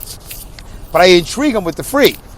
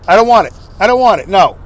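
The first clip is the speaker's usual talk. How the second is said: stubborn refusal; 3 semitones higher, 6 dB louder than usual. Retold or imitated. Stubborn dad refusing offer.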